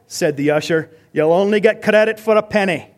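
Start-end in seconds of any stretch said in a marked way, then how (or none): 1.1-2.9 loud, higher mock Scottish retort; Scottish accent. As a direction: deliver the punchline in an amateur Scottish accent. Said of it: "That was my accent attempt".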